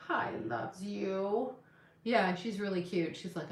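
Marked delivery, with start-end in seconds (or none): 0.1-1.5 tender cooing. Real, not acted. Cooing at the cat.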